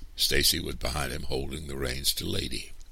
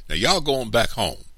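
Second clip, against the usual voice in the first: put-on raised, higher voice ordering children home; stern order; 8 semitones higher, 6 dB louder than usual. A narrator's reading. Angry man ordering children away.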